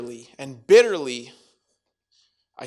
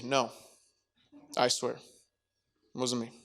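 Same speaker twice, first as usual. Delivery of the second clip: sheepish denial; 2 semitones lower, 5 dB quieter, slower than usual. Acted. Acted Peter denying nervously.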